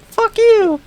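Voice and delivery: high squawking parrot screech. Retold or imitated. Imagining a swearing pet parrot.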